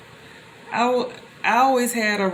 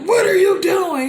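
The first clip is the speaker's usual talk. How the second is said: much higher and louder, a yelled replay; retold scream; 12 semitones higher, 8 dB louder than usual. Man yelling at the crashed girl, screaming. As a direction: bark it like the angry man at the crash. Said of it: "he was screaming at me".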